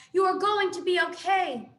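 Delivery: shouting reassurance through flames; yelled reassurance. A narrator's reading. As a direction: yell it over the roaring fire. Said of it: "Roz yelled over the roar".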